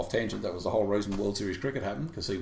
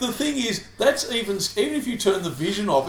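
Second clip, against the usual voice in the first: normal talking voice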